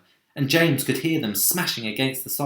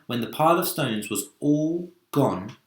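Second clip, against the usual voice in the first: usual speaking voice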